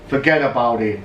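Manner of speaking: low mock-gangster "Forget about it", D's for T's. Shown as a demonstration. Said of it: "With the D's".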